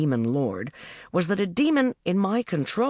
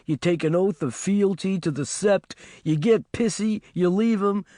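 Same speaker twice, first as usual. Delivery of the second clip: higher, sneering demon companion; a snide little demon scolding its master; mocking demon needling her about her breakup; demon Jim's voice; 4 semitones higher than usual. Demon Jim sneers at narrator.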